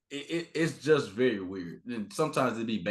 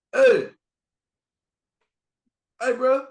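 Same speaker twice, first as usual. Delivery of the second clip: loud, high call across the room; 10 dB louder, slower than usual. Retold or imitated. Fan calling out to him in the restroom, loud. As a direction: shout out to get attention.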